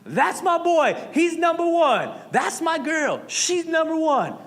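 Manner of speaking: high, loud hammed-up proud parent cheer; mock the over-the-top sports parent; bragging parent yell. Parent stands up in the bleachers bragging about their kid, hammed-up yell. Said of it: "stands up and is just like".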